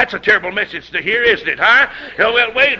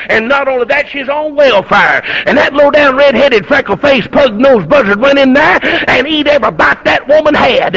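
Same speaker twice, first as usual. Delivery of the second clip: mock outrage, rising louder and higher; indignant preaching; 4 semitones higher, 4 dB louder than usual. Townsfolk gossiping about the glutton preacher, hammed-up indignation. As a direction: pile up the insults in scandalized preacher cadence.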